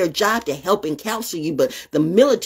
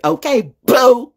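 sassy taunt; 6 semitones higher, 6 dB louder, slower than usual. Real, not acted. Taunting racists.

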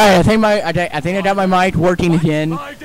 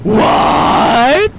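incredulous laughing; 4 semitones higher than usual. Real, not acted. Gamer cracking up at a face made when dying, incredulous laughing. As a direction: blurt it, then crack up.

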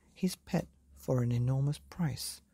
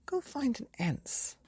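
put-on high boy voice; 12 semitones higher than usual. A narrator's reading. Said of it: "the boy replied".

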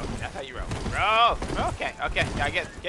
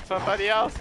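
usual speaking voice

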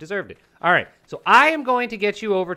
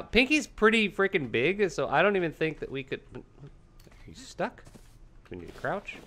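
usual speaking voice

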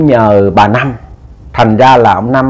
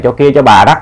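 plain reading voice

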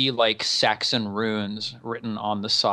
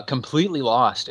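ordinary talk, nothing marked

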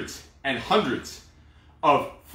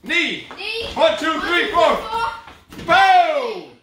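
pumped-up coach calling; 7 semitones higher, slower than usual. Acted. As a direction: fire up the class through the last round. Coach calls burnout round.

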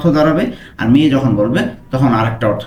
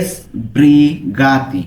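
"sbrigati" drawn out syllable by syllable; slow careful pronunciation; much slower than usual. Shown as a demonstration. Teacher slowly modelling the word for learners. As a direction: stretch every sound of "sbrigati" very slowly. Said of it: "আমরা উচ্চারণটা কিন্তু ভালো মতো খেয়াল করবো".

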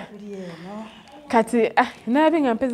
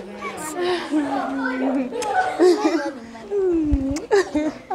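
long, higher-pitched laughing; real laughter; 4 semitones higher than usual. Real, not acted. Greeting the children, long delighted laughter. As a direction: keep laughing through the greeting.

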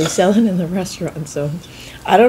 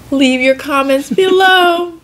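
higher, louder, slow sign-off voice; youtuber call-out; 8 semitones higher, 6 dB louder, slower than usual. Acted. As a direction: announce it to the viewers playfully. Playful call-out to viewers.

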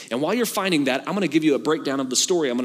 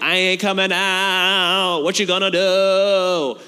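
taunting demon; much slower than usual. Retold or imitated.

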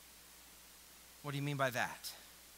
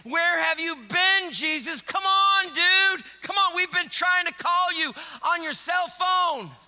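higher, louder exasperated calling; comic loud pestering; frantic friends; 6 semitones higher, 6 dB louder than usual. Acted. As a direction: whine at him like a worried buddy. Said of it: "they show up and says".

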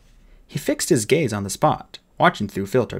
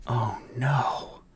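soft, whispered sigh; sighed dismay; 6 dB quieter than usual. Acted. Fairy seeing the frozen bodyguard, sighed in a whisper. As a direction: let it out on a hushed, unvoiced breath. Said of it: "sighed Holly".

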